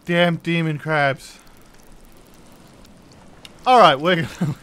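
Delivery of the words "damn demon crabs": drawn-out loud grumble at the crabs; slow annoyed curse. Real, not acted. Drag out the curse in annoyance.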